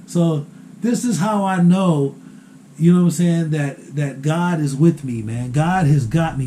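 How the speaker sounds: ordinary talk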